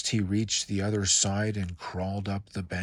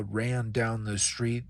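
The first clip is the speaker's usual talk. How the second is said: ordinary delivery, nothing marked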